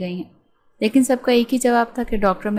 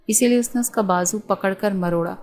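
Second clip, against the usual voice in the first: ordinary delivery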